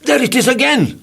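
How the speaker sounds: high startled cry; jumping into strong arms in fright, high startled cry; frightened cry. A narrator's reading. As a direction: yelp it out in fright. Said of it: "I cried".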